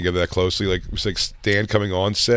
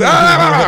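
mock shushing cries; 10 dB louder than usual. Crew guy silencing him.

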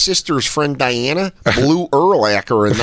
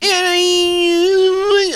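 much higher, squeaky start; mock reply; 14 semitones higher than usual. Imitating her unsure answer, squeaky high. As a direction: pitch it up like her.

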